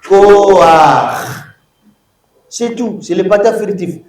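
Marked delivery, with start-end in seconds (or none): 0.1-1.2 loud, drawn-out "Koach", a before the guttural ch; furtive patah demo. Shown as a demonstration. Teacher models for the class, loud "Koach" with a before ch. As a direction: model "Koach" firmly, ending on a throaty ch. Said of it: "tu le prononces d'abord et puis le chet après".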